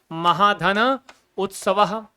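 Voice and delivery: महाधन उत्सव said very slowly, syllable by syllable; slow dictation. Shown as a demonstration. Teacher repeating the example slowly for students. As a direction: spell it out slowly. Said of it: "क्या, क्या, क्या?".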